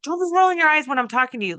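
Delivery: a parent snapping at an eye roll, retold; mother scolding her son, retold with a mock scold. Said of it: "I'd be like".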